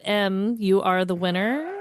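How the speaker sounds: normal talking voice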